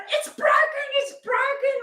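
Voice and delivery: high, hammed-up cry of pain; mock panicked wail. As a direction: replay her own panic in a wail. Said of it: "I'm going".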